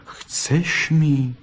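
soft, slow tender voice; tender comfort. Acted. Fox suitor tenderly comforts the crying vixen, soft and slow. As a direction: comfort her gently, almost whispering.

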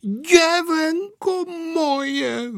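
high, long-drawn cry of affection; slow, high-pitched doting granny; grandmother exclaiming. A narrator's reading. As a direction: cry out fondly, stretching every vowel. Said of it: "vykřikla babička".

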